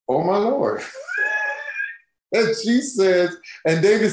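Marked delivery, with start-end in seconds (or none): 0.1-0.8 a shouted, amazed gasp of a phrase; shocked shout at seeing a star; stunned exclamation. Retold or imitated. Walks in to find [name]. 0.9-1.9 a very high, squealing burst of laughter; giddy high-pitched laugh; shrieking laugh. Real, not acted. Walks in to find [name].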